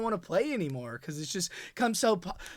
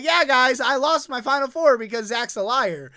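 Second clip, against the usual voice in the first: mock streamer complaint; 5 semitones higher, 5 dB louder than usual.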